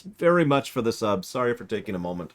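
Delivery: ordinary talk, nothing marked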